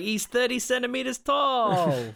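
high, drawn-out mock wail; exasperated gang member. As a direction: wail it out in hammed-up disbelief.

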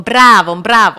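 loud falling shouts of praise; co-driver shouting praise over the engine; loud cheering. Retold or imitated. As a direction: bellow it out like cheering your driver. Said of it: "εμένα είναι πολλά τον".

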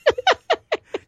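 high shrieking burst of laughter; explosive cackle. Real, not acted.

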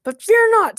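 high, loud rallying cry; grand, booming reassurance to a crowd; heroic proclamation. A narrator's reading. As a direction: proclaim it like a bombastic hero. King rallies citizens heroically.

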